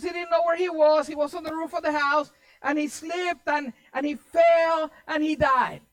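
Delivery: high, loud lecturing of a husband; acted angry wife. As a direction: instruct him indignantly, stretching the key words. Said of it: "I wouldn't be so angry at you".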